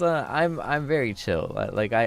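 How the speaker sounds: usual speaking voice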